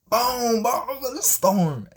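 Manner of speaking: loud, high booming sound effects; dramatic storm burst. Acted. Storyteller as the storm hits the boat, loud dramatic burst. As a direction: hit each crash loud and high.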